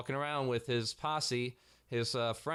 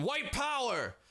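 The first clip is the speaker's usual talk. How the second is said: mock scream; 12 semitones higher, slower than usual. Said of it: "Did he go around screaming".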